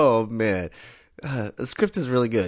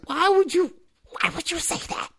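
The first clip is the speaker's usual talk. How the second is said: high, thin squeak fading softer; mock squeal of cringing disbelief; pained disbelief; 4 dB quieter than usual. Reacting to a painfully awkward scene, mock pained disbelief.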